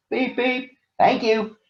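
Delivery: very high whistled beep beep; whistle beeps. A narrator's reading. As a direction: toot it like a train whistle. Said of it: "And he whistled".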